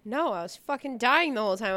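ordinary talk

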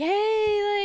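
high, loud drawn-out hey; mock pestering holler. Old schoolmates pestering her on cam, mock holler. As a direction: call out like a pushy group.

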